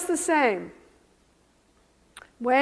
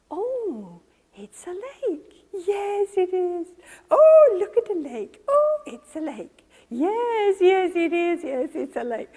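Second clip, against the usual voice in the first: gentle mothering; 6 semitones higher than usual. Acted. Good mother holding toddler's hand at the lake, gentle cooing. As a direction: coo in wonder to a small child. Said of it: "she drops her voice and she goes".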